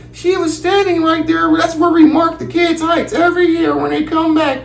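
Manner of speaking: prissy high office lady; school lady voice. Retold or imitated. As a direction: school lady telling dad about his daughter, high and prim. Lady explains height mark.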